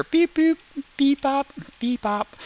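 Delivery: high beep-bops in pairs; high-pitched sound effect; imitated beeps. Shown as a demonstration. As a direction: beep and bop like placing markers. Marks imagined columns of air.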